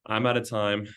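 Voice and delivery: normal talking voice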